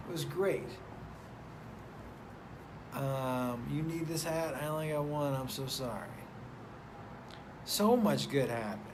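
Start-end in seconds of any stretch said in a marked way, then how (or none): none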